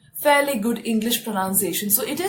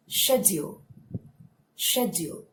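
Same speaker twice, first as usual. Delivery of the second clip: "Schedule" in a British accent, sh at the start; 3 semitones lower, 5 dB quieter than usual. Shown as a demonstration. Teacher trying the same word with the British accent. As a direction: put on a British accent, shed-yul. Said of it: "So British accent".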